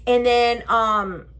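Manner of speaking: ordinary talk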